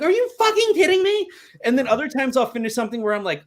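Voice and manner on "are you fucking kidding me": high, loud mock disbelief; incredulous outburst. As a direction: throw up your hands, can't believe it. Reacting to buyer of hated piece.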